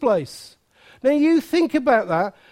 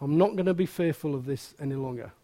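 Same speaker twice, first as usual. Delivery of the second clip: quiet resolve; 8 semitones lower, 7 dB quieter, faster than usual. Retold or imitated. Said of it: "it takes some people to say".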